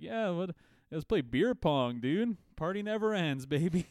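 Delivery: high, loud party bro shout; frat bro. Retold or imitated. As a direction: hype it like a drunk frat guy. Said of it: "They're just like".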